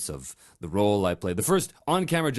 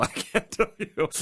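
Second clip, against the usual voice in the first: laughing; 9 semitones higher than usual. Real, not acted. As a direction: laugh breaking into the words. Laughs at failing the title.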